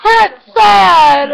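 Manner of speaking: high, loud mock-sad cry; mock wail. Real, not acted. Kid mock wailing at a sad post. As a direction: wail it like fake sorrow.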